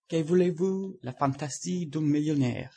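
put-on lightly French-accented aside; French phrase. A narrator's reading. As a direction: toss off the French line airily.

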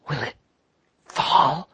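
ordinary delivery

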